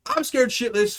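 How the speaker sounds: normal talking voice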